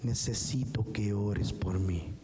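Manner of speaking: low, slow, subdued imitation of someone crying; understated plea for prayer, voiced slowly and low; retold tearful plea. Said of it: "llorando, me dijo". (súplica llorosa imitada, lenta y grave)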